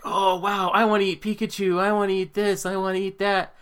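high-pitched imitation of an excited child; gushing little-kid voice, high throughout. Kids at the birthday party begging for cake, excited mock kid voice. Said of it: "the kid's like".

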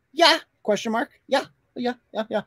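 mock Laura ending on yeah; imitating Laura. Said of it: "she always ends it with a comma".

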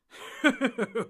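loud booming laugh; hearty laugh. Acted. Tom laughing at the thankful hobbits, hearty. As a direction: burst into a big belly laugh. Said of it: "burst out laughing".

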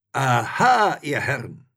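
loud, high "caught you" cry; called out. A narrator's reading. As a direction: robbers pouncing on their discovery. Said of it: "riefen sie".

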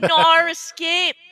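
slow, drawn-out mock Australian Nar Escape; Australian accent. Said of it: "does that mean we have Nar Escape?".

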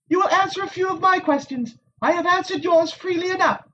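high, poised noblewoman's voice; composed Aes Sedai. A narrator's reading. Moiraine pressing the Wisdom, cool and high. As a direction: speak down evenly like a knowing sorceress.

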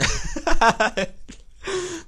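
loud high-pitched laugh; high burst of laughter. Real, not acted. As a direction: cackle at your own joke. Laughs at his own joke.

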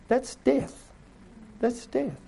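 high, pointed pronouncement; high verdict. Real, not acted. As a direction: land it up high. Preacher's verdict, repeated.

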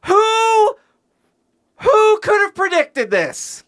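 very high, loud outraged wail; tantrum. Real, not acted. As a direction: howl it slowly in outrage. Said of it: "is throwing a tantrum".

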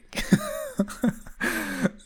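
high-pitched giggle; amused laugh. Real, not acted. Amused by his own remark.

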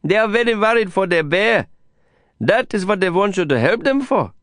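high, lilting Indian English voice; Prabhakar's voice. A narrator's reading. Prabhakar pleading for the handlers' bear, high and earnest. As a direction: carry his worried plea high and earnest.

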